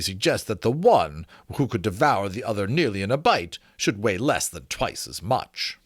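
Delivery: put-on measured, formal reading of an old treatise; formal reading aloud. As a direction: read it like a learned gentleman's letter. Reads excerpt aloud.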